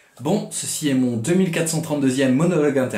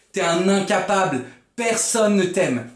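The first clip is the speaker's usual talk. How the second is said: higher-pitched nasty inner voice; mean taunting; 6 semitones higher than usual. Acted. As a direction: taunt cruelly, building in pitch. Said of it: "il dit que des trucs méchants".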